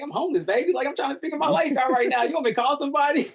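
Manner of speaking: high, fast, disbelieving; incredulous high self-quote. Retold or imitated. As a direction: play the absurdity of it, high and quick. Incredulous retold reply.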